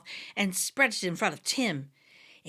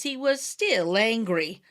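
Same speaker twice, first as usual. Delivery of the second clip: ordinary delivery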